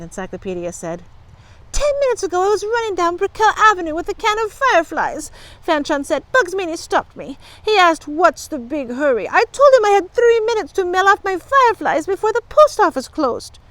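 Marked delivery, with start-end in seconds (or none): 1.7-5.3 put-on high, urgent young girl's voice; upset girl client. A narrator's reading. Fanchon tells Encyclopedia what happened, upset. 6.3-13.6 put-on high, urgent young girl's voice; upset girl client. A narrator's reading. Fanchon tells Encyclopedia what happened, upset.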